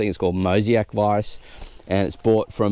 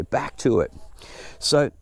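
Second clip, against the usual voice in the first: ordinary talk, nothing marked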